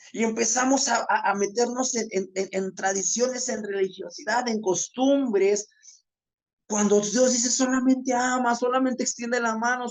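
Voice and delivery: high-pitched impassioned preaching, pressing each point; fervent preaching. Acted. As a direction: preach it with urgency, pleading with the congregation. Preaching with rising fervor. (predicación fervorosa y apasionada, con el tono alto)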